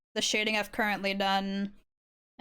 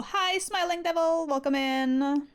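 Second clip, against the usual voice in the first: high bright streamer hello; cheery high greeting; 7 semitones higher than usual. Real, not acted. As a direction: greet them high and sing-song.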